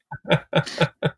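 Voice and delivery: high airy chuckling; laughter. Real, not acted. Host laughing along at the joke, laughter.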